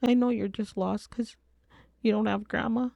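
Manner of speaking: put-on high-pitched child's voice; reenacted nine-year-old, pitched well up; young daughter. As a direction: imitate a little girl gently explaining. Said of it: "She said".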